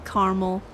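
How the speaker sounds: caramel said "KAR-mul"; two-syllable variant. Shown as a demonstration. Streamer listing the versions, two syllables. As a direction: say caramel as "carmel". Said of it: "People like to say caramel".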